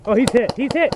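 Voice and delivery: high urgent callout, rising; man down shout. Real, not acted. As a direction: call it out fast to the team. Calling out hit enemy.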